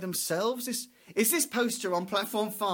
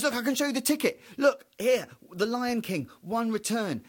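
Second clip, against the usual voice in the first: mock eager, a bit louder, showing the ticket; eager husband; 5 semitones higher, 6 dB louder than usual. Husband to his wife, eager and a bit louder. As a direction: push the ticket at her, eager to be believed.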